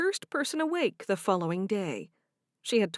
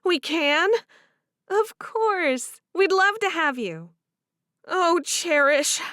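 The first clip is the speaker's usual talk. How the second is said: higher and louder, complaining; frustrated young wife; 8 semitones higher, 7 dB louder, slower than usual. Acted. Favor complaining about Harriet, higher and aggrieved. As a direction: vent about the mother-in-law. Voiced character dialogue.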